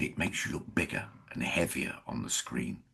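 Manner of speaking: low, hushed imitation of a friend's warning; confiding. Co-star warns actor about looking heavier on screen, confiding. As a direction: explain it quietly, as a private tip. Co-star's confidential advice retold.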